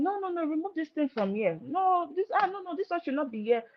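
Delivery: high, fussy scolding; a replayed scolding from early marriage; irritated nagging. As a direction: nag him about the clutter. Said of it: "I used to get irritated about it".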